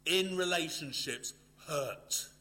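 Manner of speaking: loud, high, driving pulpit voice; forceful preaching. Acted. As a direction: preach it out to the hall with full force.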